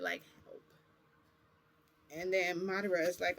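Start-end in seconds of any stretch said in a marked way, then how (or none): 0.4-0.6 silently mouthing the word Help through glass; mouthing help behind the glass; mouthed, barely voiced. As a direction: shape "Help" with almost no sound. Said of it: "Like, he was mouthing it".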